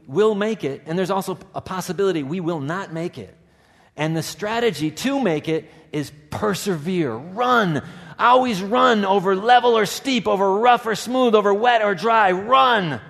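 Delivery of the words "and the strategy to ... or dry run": loud, high preaching echo of the hermit; urgent exhortation. Acted. Pastor urging the congregation to persevere, loudly. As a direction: drive it home to the congregation at full voice. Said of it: "pastor echoes the hermit's cry".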